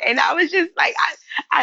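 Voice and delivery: usual speaking voice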